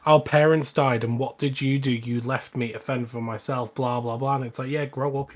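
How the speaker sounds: ordinary talk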